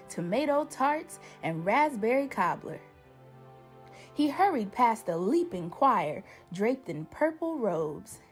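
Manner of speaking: ordinary delivery, nothing marked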